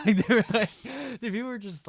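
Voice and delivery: laughing through the words. Real, not acted. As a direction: laugh while telling it. Laughing while telling.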